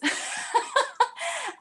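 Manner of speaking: a sudden high laugh at herself; high burst of laughter. Real, not acted. Laughing at herself for saying no so often, high burst of laughter. Laughs at her repeated refusals.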